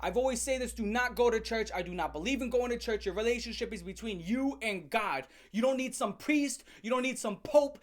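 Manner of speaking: ordinary talk, nothing marked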